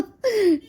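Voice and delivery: high burst of real laughter; loud high giggle. Real, not acted. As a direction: crack up at the sister's antics. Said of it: "मुझे हंसी आ रही थी".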